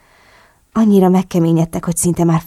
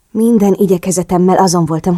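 usual speaking voice

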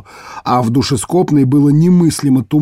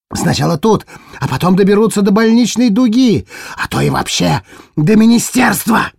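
yelling; 8 semitones higher than usual. Acted. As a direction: rant it out at the top of the voice. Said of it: "Ерл проорал".

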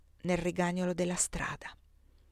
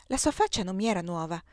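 ordinary delivery, nothing marked

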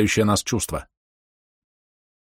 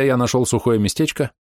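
ordinary delivery

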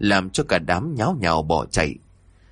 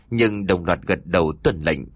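usual speaking voice

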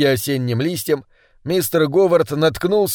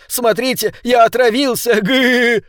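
put-on high-pitched, gleeful boy; giddy, squeaky glee; joyful chatter; 8 semitones higher than usual. A narrator's reading. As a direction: show off excitedly to your friends. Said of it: "радостно затараторил".

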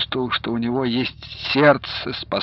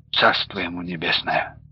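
sighing; 6 semitones lower, 5 dB quieter than usual. A narrator's reading. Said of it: "вздохнул Платов".

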